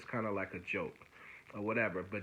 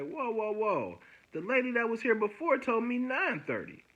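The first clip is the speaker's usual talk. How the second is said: much higher, insistent objection; retold indignant protest; 12 semitones higher, 5 dB louder than usual. Objecting to the desk lady about nine thirty, indignant protest. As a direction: cut in and protest, pitch jumping up.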